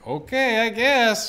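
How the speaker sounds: high, loud, hammed-up resignation; mock acceptance. Wife accepting her husband's severe pain, too readily.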